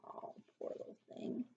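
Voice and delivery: soft unvoiced sigh of sympathy; breathy whisper of sorrow for the mouse; whispered pity. Real, not acted. Pity aside to child.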